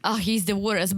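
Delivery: ordinary talk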